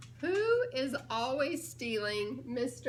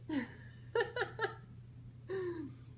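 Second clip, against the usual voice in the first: soft giggle at the joke; light delighted laughter; amused laugh; 5 semitones higher, 6 dB quieter than usual. Real, not acted. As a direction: chuckle at the picture. Laughs at faint elephant.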